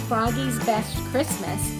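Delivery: usual speaking voice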